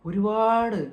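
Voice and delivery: loud, high burst. Shown as a demonstration. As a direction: push the word out loudly. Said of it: "പിന്നുള്ളത് volume".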